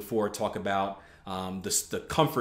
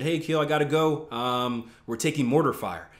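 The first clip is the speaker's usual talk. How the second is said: usual speaking voice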